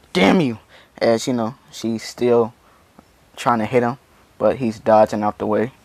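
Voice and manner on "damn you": higher, louder frustrated outburst; acted angry curse. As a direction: curse your opponent in mock fury. Losing fighter curses her opponent.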